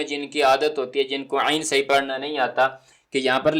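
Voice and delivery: ordinary talk, nothing marked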